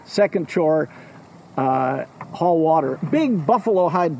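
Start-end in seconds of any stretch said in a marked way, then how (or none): none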